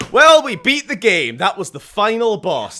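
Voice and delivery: loud, high victory cheer; triumphant. Real, not acted. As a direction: crow about the win. Boss defeated.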